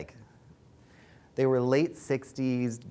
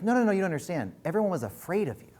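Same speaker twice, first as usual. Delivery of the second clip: insistent friend; 8 semitones higher, faster than usual. Retold or imitated. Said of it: "And she's like".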